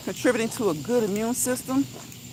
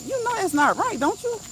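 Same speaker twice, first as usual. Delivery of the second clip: high-pitched, disbelieving check; mock challenge; 10 semitones higher than usual. Challenging adults who hear things wrong, high-pitched disbelief. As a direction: question them, pitch climbing high.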